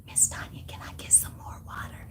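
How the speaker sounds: whispered request imitating a sneaky client; hushed client.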